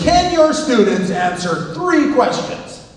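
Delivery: imitating a loud keynote speaker; loud keynoter. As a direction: boom it out to the hall. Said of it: "he's a really loud guy".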